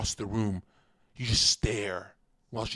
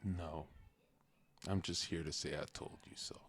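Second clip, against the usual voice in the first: soft conspiratorial whisper; whispered gloat; 7 semitones lower, 14 dB quieter than usual. Acted. Streamer whispers a gloating confession to his chat. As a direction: breathe it out like a secret.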